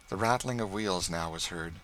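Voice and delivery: plain reading voice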